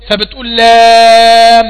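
لام stretched very long and loud; long madd on lam. Shown as a demonstration. Teacher showing students the long pause on لام. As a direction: hold the aa in لام long and strong. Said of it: "سيادتك هتقف على اللام".

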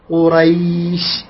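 قريش slowly, long smooth "ay" before the sh; soft leen glide. Shown as a demonstration. Teacher shows how to bring it out gently, lengthened leen. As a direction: draw out the ي of قريش with ease. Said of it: "هكذا".

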